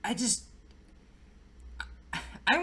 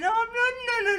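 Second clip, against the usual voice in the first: mock panic; 13 semitones higher than usual. Retelling Jack's guillotine panic.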